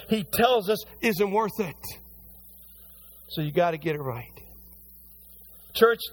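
high, loud, driving sermon delivery; fiery pulpit preaching; preaching at full force. Acted. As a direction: preach it hard to the congregation.

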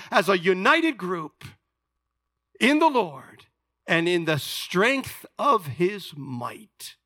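slow, emphatic declaration with long pauses between phrases; word for word. Shown as a demonstration. Preacher gives congregation his literal Greek translation, slow emphatic word-for-word delivery. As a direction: lay out each phrase slowly and weightily. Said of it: "put it all together with the final words".

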